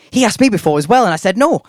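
high, loud mock South African accent. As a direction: imitate an indignant South African barmaid's accent. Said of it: "said in a South African accent".

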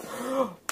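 sharp gasp for air as the gills vanish; relieved gasp. Acted. Fish-man finally breathing, one sharp gasp. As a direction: gulp in air at last.

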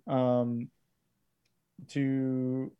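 normal talking voice

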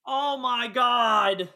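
long drawn-out high wail; exasperated. Real, not acted. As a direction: despair slowly at their ignorance. Despairing at co-host's mix-up.

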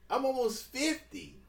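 high, loud comic indignation; loud, high-pitched protest; exasperated shout. Real, not acted. Exasperated banter about their ages.

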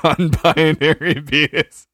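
high, loud, drawn-out through laughter; laughing repeat. Real, not acted. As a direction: stretch the silly pun while cracking up. Cracking up repeating the pun.

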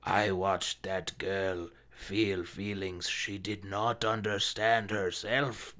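put-on slow, creepy old narrator; Tom Hanks creepy voice. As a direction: drag it out like a creepy old man. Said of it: "the old-ass creepy voice of Tom Hanks in the background going".

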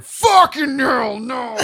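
high, loud, drawn-out holler; hammed-up slow shout; shouted refusal. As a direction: bellow an over-the-top refusal. Emphatic answer to own question.

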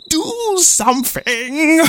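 very high, slow desperate scream; screaming chief. Acted. Chief screaming at Rain, desperate. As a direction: shriek in panic. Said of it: "screamed the chief".

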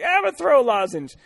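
high, loud mocking voice. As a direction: squeak it out mockingly. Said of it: "I'm like".

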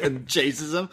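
ordinary delivery

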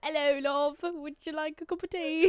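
a cheesy fake British greeting; shitty British accent. American friend hams up a bad British accent. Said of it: "I do the shitty British accents".

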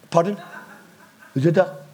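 ordinary talk, nothing marked

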